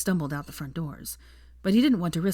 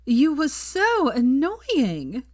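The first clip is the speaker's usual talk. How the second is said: mock anguish; 8 semitones higher, 5 dB louder, much slower than usual. A narrator's reading. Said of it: "cried Pansy in anguish".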